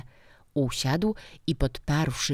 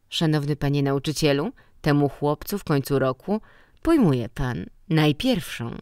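ordinary delivery, nothing marked

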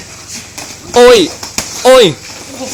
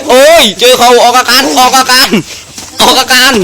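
excited shout; 4 semitones higher than usual. Acted. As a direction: shout it as the fighter wobbles. Excited call of fighter in trouble.

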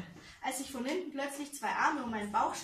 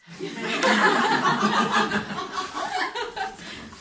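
long high airy laughing fit; real helpless breathy laughter; high breathless laughter; 10 semitones higher, 13 dB louder than usual. Real, not acted. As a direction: collapse into silent giggles. Laughing fit while reading.

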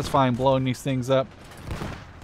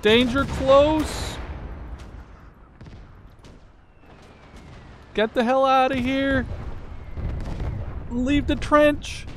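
urgent shouting; 12 semitones higher, slower than usual. Real, not acted. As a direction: shout the warning. Artillery incoming, urgent orders.

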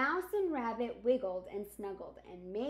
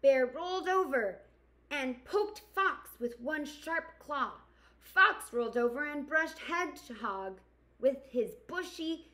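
gentle storybook narration; reading to children; 4 dB louder than usual. Acted. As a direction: read it like storytime for kids. Reads picture book aloud.

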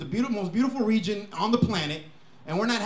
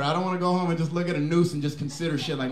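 normal talking voice

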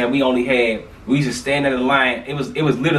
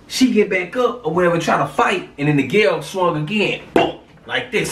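ordinary delivery

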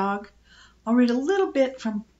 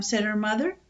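ordinary delivery, nothing marked

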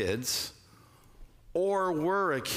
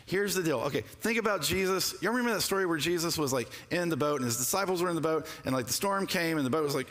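ordinary talk

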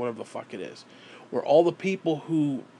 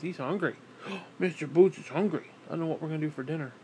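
usual speaking voice

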